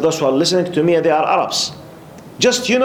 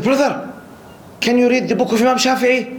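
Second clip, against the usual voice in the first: much higher voice, insistent quizzing; challenging questioner; 8 semitones higher than usual. Retold or imitated. Man in the mosque tests the newcomer, challenging. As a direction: imitate an earnest brother testing someone, pitched right up. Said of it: "He was asking me in this attitude".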